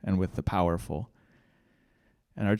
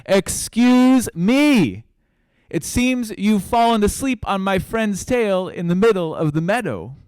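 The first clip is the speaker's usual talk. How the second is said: shouting up; 9 semitones higher, 5 dB louder than usual. A narrator's reading. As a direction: call up loudly, stretching the words out. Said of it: "And he shouted up to those giant elephant ears".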